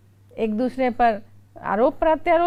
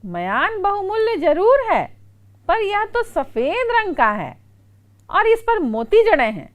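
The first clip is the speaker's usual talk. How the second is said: higher, insistent protest; indignant objection; 7 semitones higher, 3 dB louder than usual. Retold or imitated. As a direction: contradict him, sure you are right. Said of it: "तो कड़क सिंह ने कहा". (ऊँची आवाज़ में नाराज़ विरोध)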